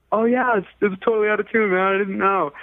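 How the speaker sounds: put-on high, easygoing teen owning up; a lightly played oblivious kid checking the tuner; sheepish realization. Teen realizes guitar untuned.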